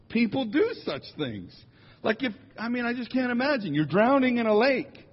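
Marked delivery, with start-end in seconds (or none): none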